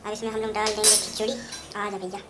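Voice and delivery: ordinary delivery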